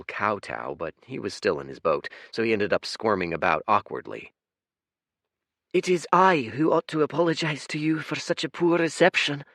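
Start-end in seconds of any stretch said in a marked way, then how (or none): 5.7-9.4 higher but gentle, calm and apologetic; soft kind voice. Acted. Kind blue twin apologizing to Fate, soft gentle voice. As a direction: soothe the guest. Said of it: "said a softer voice".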